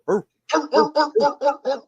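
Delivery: high mock barking; dog barking. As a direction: bark like a dog. Barking after dog song.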